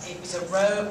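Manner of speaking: ordinary talk, nothing marked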